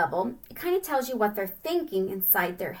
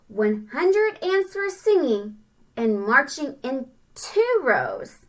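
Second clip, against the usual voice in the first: very slow, louder and higher storybook read; slow sing-song read-aloud; 4 semitones higher, 4 dB louder, slower than usual. Acted. Teacher reading the ants' march, slow and sing-song. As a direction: stretch it out for the kids.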